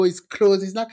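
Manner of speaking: normal talking voice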